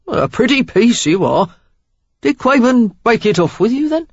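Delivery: flirty, loud Cockney charmer; Cockney rogue sizing her up; Cockney accent. A narrator's reading. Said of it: "Cockney in his accent".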